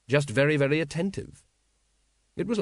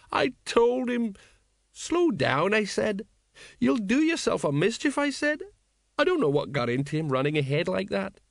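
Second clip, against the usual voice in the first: maudlin lament; 8 semitones higher than usual. A narrator's reading. Tipsy sergeant lamenting his reckless dead comrade, plaintive. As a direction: wail it mildly, pitched right up.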